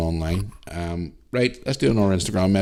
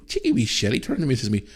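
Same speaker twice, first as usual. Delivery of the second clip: usual speaking voice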